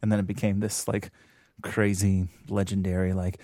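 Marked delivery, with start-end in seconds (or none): none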